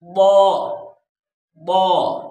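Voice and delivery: "बौ" as Arabic dad, emphatic, never z; correct heavy dad. Shown as a demonstration. Teacher correcting the z mistake, emphatic "बौ". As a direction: press out a rounded heavy "बौ". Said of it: "फिर बौद, आ, आप अदा करना है".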